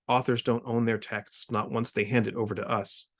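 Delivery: plain reading voice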